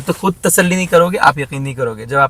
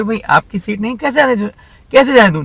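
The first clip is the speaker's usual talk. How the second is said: mock exasperated camp official; 8 semitones higher than usual. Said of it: "बोले".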